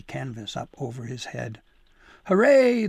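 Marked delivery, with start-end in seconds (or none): 2.3-2.9 far louder, much higher cheer; crowd shout. Acted. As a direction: shout it out over the sleigh. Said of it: "the crowd shouted again".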